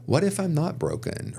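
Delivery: WHAT IF pitched high, then falling on BROKEN; posed as a question. Retold or imitated. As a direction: ask it tentatively, high on "What if". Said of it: "I had to use it as a question".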